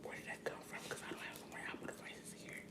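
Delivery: hushed, breathy whisper to the camera. Real, not acted. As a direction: whisper it like you don't want the phone to hear. Startled by phone assistant speaking.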